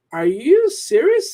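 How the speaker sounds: startled question, pitch jumping up; surprised. Real, not acted. Reading a viewer's comment, real loud surprise. Reacting to surprising exchange rate.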